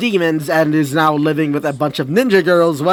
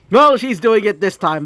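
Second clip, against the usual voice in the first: usual speaking voice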